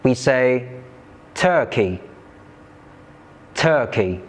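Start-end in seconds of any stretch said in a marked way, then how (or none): 1.3-4.2 "turkey" with stronger first syllable, falling after; "turkey" stressed on "tur", said as a model. Shown as a demonstration. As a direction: hit the "tur" in "turkey", let "key" drop. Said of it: "stress mark is before the first syllable".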